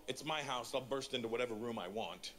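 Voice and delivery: usual speaking voice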